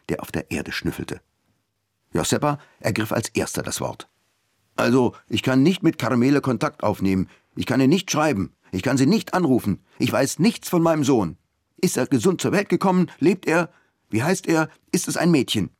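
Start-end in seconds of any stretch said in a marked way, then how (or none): 4.7-15.7 lightly voiced Joseba, pleading and worried; a hint of a frightened father; anxious young father. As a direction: pour out the worry about the baby.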